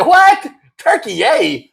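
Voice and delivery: high startled "What?" then the odd name; retold disbelief. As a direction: replay your stunned reaction. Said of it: "I said".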